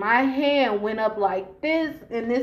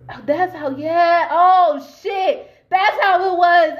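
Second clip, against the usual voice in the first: excited realization; 7 semitones higher, 7 dB louder, slower than usual. Real, not acted. Sudden memory of the park layout.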